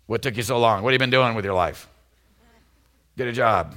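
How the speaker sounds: mock scolding father demanding a job; mock stern father. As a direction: nag like an impatient father. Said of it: "you know what Father God said".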